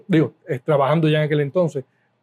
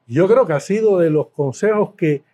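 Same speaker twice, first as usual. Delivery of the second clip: normal talking voice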